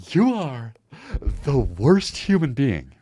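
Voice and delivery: drawn-out, pointed delivery of an insult; hammed-up movie insult; mock movie villain. Streamer quoting the Smile scene, mock menacing.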